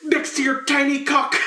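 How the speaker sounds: mock the rival in a high, theatrical voice; Dauphin. Imitating the Dauphin taunting Henry the Fifth, high mock taunt. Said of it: "he's just like".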